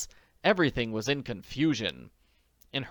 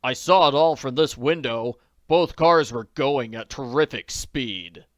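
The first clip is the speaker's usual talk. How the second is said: louder, slowish witness; bystander; 7 dB louder than usual. Acted. Witness tells diners, firm bystander voice. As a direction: report what you saw, firm.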